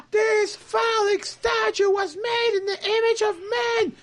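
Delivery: high, slow yelled rally cry; hammed-up imitation of an activist yelling at a monument; megaphone protest shout. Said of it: "doing this with a megaphone".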